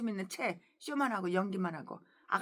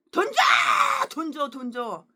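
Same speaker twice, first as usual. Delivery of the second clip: mock greedy yell; 5 dB louder, much slower than usual. Greedy people demanding money from her, mock yell fading. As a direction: shout the demand then let it die off.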